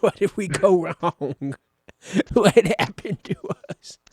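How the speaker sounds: a bad, lightly voiced Matt Damon pining; terrible Matt Damon. Friend doing a bad Matt Damon missing Ben, mock heartbroken. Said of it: "That's a terrible Matt Damon impression".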